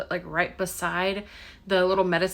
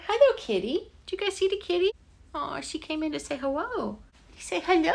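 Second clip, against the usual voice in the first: much higher cooing pet voice; 9 semitones higher than usual. Real, not acted. Baby talk to the cat.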